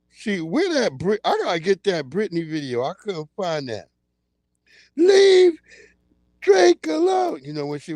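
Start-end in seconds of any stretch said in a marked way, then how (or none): 4.9-7.4 much higher, loud wailing plea; crying shout. Retold or imitated. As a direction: beg them to leave Drake alone, voice cracking. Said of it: "Miss V talking about".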